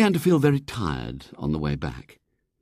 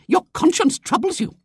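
very high, impassioned lady's tirade; righteous denouncing lady; 4 dB louder than usual. A narrator's reading. Visitor denounces William's father, righteous. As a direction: harangue like a temperance crusader.

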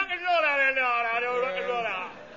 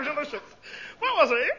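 ordinary talk, nothing marked

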